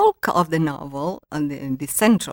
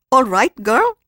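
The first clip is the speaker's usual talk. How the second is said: higher, louder playful call; lightly acted teasing camaraderie; with a wink; 6 semitones higher, 7 dB louder than usual. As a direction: imitate Dad's chummy, winking address. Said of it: "when he said it with a wink".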